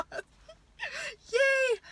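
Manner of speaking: high, squealing little yay; excited squeal. Real, not acted. Mother gushing over the atheism book for her daughter, squealing with joy. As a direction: burst into a giddy cheer. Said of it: "I'm excited".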